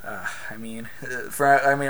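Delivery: usual speaking voice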